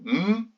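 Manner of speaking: "m" held as a long hum; son m isolé. Shown as a demonstration. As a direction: sound out "m" alone, not the letter name. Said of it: "on trouve deux sons". (son « m » tenu, bouche fermée)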